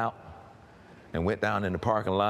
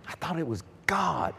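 whisper; 9 semitones higher than usual. Real, not acted. Confessing past belief.

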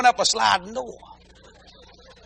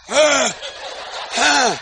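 dog panting; 4 semitones higher than usual. Retold or imitated. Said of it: "a dog going".

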